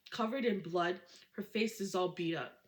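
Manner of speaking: ordinary delivery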